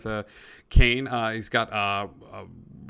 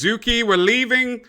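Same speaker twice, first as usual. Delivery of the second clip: high, loud summons; calling out; 10 semitones higher, 7 dB louder than usual. Acted. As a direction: call a companion to come along. Gentleman calls his companion away.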